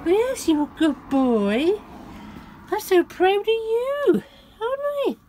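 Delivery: high pet voice breaking into a squeal. Real, not acted. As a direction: coo over the dog, almost crying with pride. Praising the dog, voice cracking.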